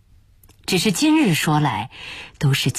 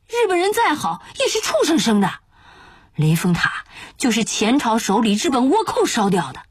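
high-pitched outraged tirade; bitter waiter railing in a raised pitch; gnashing, indignant cursing; 7 semitones higher than usual. Acted. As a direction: argue hotly, voice pitched way up. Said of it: "突然咬牙切齿地骂道".

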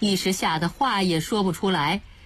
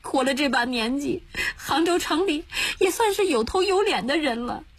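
high, tearful wailing complaint; crying lament; 10 semitones higher than usual. Acted. Old woman follows husband into the rain, lamenting. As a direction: cry it out in the rain. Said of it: "哭着说".

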